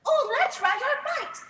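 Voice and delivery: high, bright little pig voice; Peppa voice. Acted. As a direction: squeak it like a small piggy. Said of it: "says Peppa".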